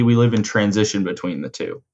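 normal talking voice

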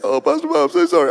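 high-pitched imitation of a weeping assistant; hammed-up tearful apology; mock tearful. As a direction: act out a distraught, apologetic caller. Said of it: "She's in tears".

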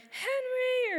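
high sing-song call across the yard; a parent calling a lost kid from the deck; calling out a name. Retold or imitated. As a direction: call a child home from far off. Parents calling kids from woods.

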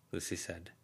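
ordinary delivery, nothing marked

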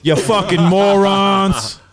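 high, slow, drawn-out insult; mock outrage. Mock insult after being voted out.

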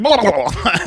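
loud cartoon gibbering noise; imitated crazed tongue-wagging gibberish; Mask-like babble. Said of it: "he's turning into the mask".

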